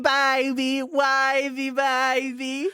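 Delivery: high-pitched, drawn-out drawl; Grace Lilly impression. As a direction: do a high, spacey reality-star voice. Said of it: "if I can do my Grace Lilly voice".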